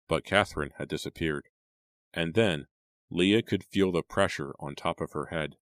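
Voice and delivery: ordinary delivery, nothing marked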